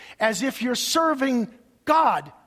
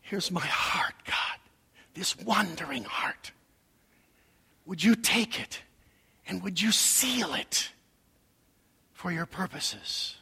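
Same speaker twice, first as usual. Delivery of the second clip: soft, low plea to God; hushed and reverent, sinking at the end; hushed prayer; 3 semitones lower, 7 dB quieter than usual. Real, not acted. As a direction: pray it quietly, with gaps between phrases. Prays the hymn back to God.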